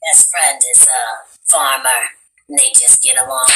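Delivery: put-on low husky whisper of an old farmer woman; old Southern smoker lady voice; old smoking lady. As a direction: rasp it low like a lifelong smoker. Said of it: "two old smoking ladies".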